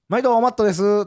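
trying out a Kansai-flavoured channel greeting; Kansai shopkeeper greeting. Shown as a demonstration. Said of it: "関西弁の感じやから".

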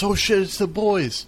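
high retold warning yell; panicked yell. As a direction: shout the alarm to friends. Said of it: "somebody yells".

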